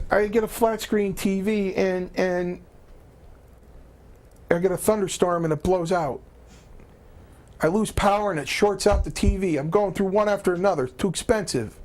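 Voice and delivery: high-pitched complaining caller impression; Chief. Old boss complains about his TVs, high aggrieved impression. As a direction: imitate a baffled man griping, voice pitched well up. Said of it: "he goes".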